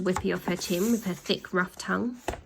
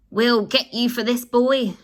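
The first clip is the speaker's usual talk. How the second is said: louder threat; shouted; 4 semitones higher, 6 dB louder than usual. Acted. Upside-down villain threatens the boy, louder.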